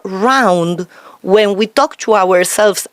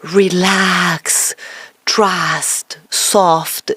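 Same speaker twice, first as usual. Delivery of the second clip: round voice; 4 semitones lower, much slower than usual. Shown as a demonstration. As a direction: speak from the diaphragm, calm and grounded. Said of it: "we talk like this and we say".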